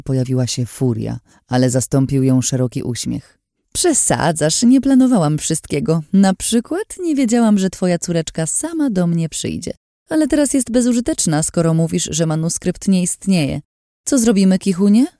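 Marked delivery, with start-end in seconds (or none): 3.7-15.1 much higher, sing-song taunting woman; mocking villainess. A narrator's reading. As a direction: tease him with false sweetness. Lin's voice held.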